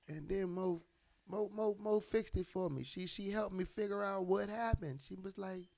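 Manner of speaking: ordinary talk, nothing marked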